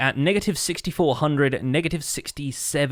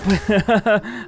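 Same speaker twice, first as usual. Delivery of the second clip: nervous laugh; 7 semitones higher than usual. Real, not acted. Nervous laugh under attack.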